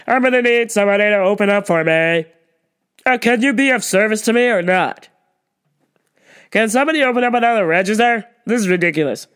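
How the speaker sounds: loud, demanding customer call; imitating the pushy shopper demanding service, loud; entitled customer. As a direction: snap for attention like you're owed it. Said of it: "she wanted her own line".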